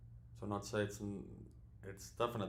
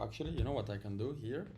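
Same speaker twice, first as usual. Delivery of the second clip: ordinary talk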